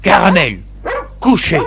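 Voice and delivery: storyteller's mildly stern command; mild big-voice command. A narrator's reading. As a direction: order the dog down with some force. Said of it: "prend sa grosse voix et dit".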